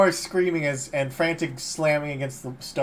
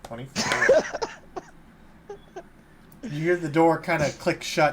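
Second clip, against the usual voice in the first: usual speaking voice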